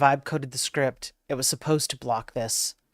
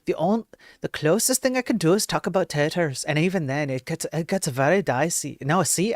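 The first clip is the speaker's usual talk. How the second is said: put-on a light, failing Irish accent, higher and louder; attempted Irish accent; 4 semitones higher, 5 dB louder than usual. Streamer attempting an Irish accent for chat about taters. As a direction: try an Irish accent and lose it. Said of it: "the Irish accent".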